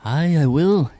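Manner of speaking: quick loud Irish "aye"; hearty Irish pirate yes; Irish accent. Acted. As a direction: answer like an Irish sea captain. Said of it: "gonna do an Irish accent for the captain".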